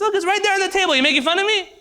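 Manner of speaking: bursting through the door, outraged; high indignant outburst; outraged priest. Acted. Priest points at fish, furious.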